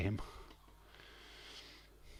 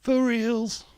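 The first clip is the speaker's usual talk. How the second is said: squeaky yelp; 13 semitones higher, 7 dB louder than usual. Acted. Jokey exclamation after impression.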